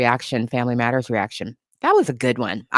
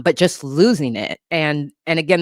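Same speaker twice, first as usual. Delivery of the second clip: normal talking voice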